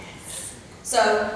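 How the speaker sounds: usual speaking voice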